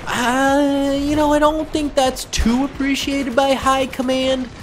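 high, slow, drawn-out squeak; comically high-pitched understatement; sheepish mock admission. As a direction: hedge it in a strained high voice. Mock reluctant admission about teamkill.

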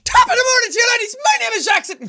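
high, loud greeting cracking into a laugh; intro shout. Acted. Flubbing his intro and laughing, loud and high. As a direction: yell it, then lose it laughing.

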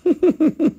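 high, loud burst of laughter; real laugh. Real, not acted. Seller amused after the accent bit, real high laugh.

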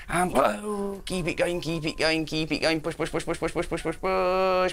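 high, driving encouragement; urgent coaching push. Real, not acted. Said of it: "coach urges patient blowing out".

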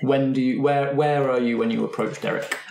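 ordinary talk

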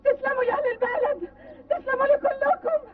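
high, crying voice, thanking through tears; tearful thanks. Acted. Grieving mother thanks the villagers of her town, crying high. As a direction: choke out the thanks between sobs.

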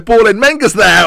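rising to the goal call; excited commentary. Real, not acted. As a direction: goal building in live play-by-play. Live goal commentary builds.

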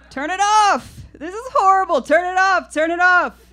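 put-on high, loud, frantic old woman begging; panicked grandmother. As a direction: shriek it out like a grandma who can't bear snakes on TV.

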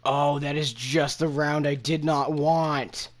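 loud, exasperated groaning complaint; frustrated outburst. Real, not acted. Bad draft round, venting at game.

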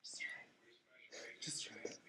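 slow whisper; whispered coaxing. Acted. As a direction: coax her in a hush. Mother coaxes in whisper.

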